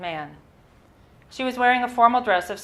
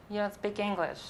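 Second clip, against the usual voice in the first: deflated; 2 semitones higher, 9 dB quieter than usual. Retold or imitated.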